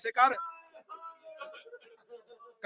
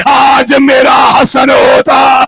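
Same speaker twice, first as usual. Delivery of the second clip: preaching at full force; 3 semitones higher, 15 dB louder than usual. Acted. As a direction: build to a grieving shout. Zakir raises majlis to full force. (پوری قوت سے مجلس کا بین)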